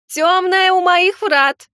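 slow, very high, loud wail; wailing gargoyle. Acted. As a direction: howl the warning in despair. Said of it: "продолжала голосить статуя".

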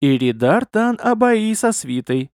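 slow, high, weighty announcement; measured, portentous delivery; significantly. A narrator's reading. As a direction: announce it with deliberate importance. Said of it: "значительно произнёс безопасник".

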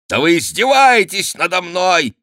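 high, loud outburst of indignation; outraged cry. A narrator's reading. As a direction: cry out, stung by mockery. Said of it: "вскричал, побледнев граф". (возмущённый крик)

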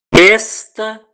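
a ringing, proclaimed opening word; emphatic declaration. A narrator's reading. Reader voicing Geu declaring God's word, loud emphatic declaration. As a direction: launch it loud and high. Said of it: "que declarou".

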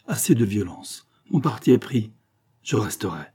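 plain reading voice

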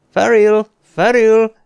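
loud, high-pitched shouts; calling a name. A narrator's reading. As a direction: call out the name urgently from outside. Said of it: "hogy a nevét kiabálják". (hangosan, magas hangon kiáltva)

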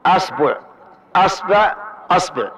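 أصبع said with a on hamza, the ba varied each time; fatha on hamza. Shown as a demonstration. Teacher lists the readings of أصبع with fatha on hamza. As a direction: read أصبع three ways, each starting with a. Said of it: "نأتي بفتح الهمزة".

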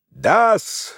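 thunderous shout; a drunk clerk's thunderous cry. A narrator's reading. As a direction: bellow it out. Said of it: "закричал он громовым голосом".

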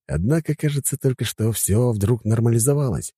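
ordinary delivery, nothing marked

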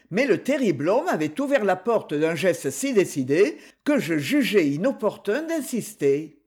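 ordinary delivery, nothing marked

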